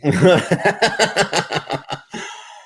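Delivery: high, loud burst of laughter. Real, not acted. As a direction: crack up laughing. Laughing at the crude joke.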